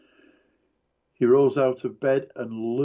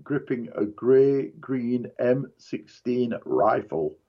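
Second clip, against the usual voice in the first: plain reading voice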